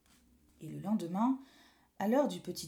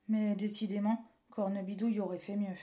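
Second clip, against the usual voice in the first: ordinary delivery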